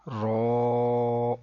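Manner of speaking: رو with rounded heavy o briefly held; measured letter length. Shown as a demonstration. Teacher recites the alphabet, rounded drawn-out رو. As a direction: sustain the full vowel of رو evenly. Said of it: "ایک second کے برابر لمبا کریں گے".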